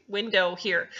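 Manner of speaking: ordinary talk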